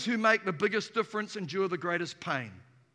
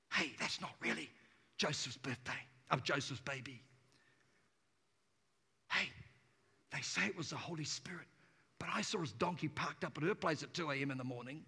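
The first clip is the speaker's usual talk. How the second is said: low, very soft gossiping voice; murmured mock gossip, kept low; hushed gossip; 8 semitones lower, 10 dB quieter than usual. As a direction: pass on a scandal under your breath. Gossips about Mary and Joseph.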